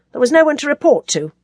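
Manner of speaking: brisk soldierly answer; curt, stern. A narrator's reading. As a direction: report stiffly to a superior. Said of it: "Jasper's voice fell into step".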